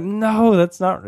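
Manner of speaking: high insistent no; mildly voiced exasperated kids; high children's protest. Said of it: "And they're like".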